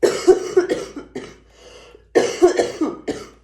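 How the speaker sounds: harsh, sick coughing; loud hacking cough; coughing fit. Real, not acted. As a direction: cough hard through a cold. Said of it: "So sorry about the coughing".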